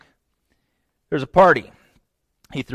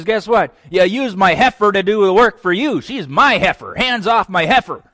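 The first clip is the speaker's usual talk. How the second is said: possessive outburst; 7 semitones higher, 8 dB louder than usual. Retold or imitated. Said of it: "and he says".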